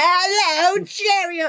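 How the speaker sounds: very high, loud cartoon British voice; caricature British accent. Shown as a demonstration. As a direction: squawk out a silly mock-British greeting. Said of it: "I'm doing a caricature".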